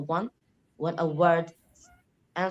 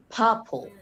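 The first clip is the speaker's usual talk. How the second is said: PUR-ple, first syllable higher and louder; stress on first syllable; 4 semitones higher, 5 dB louder than usual. Shown as a demonstration. Student showing stressed syllable, PUR in purple stressed. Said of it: "the first one is usually".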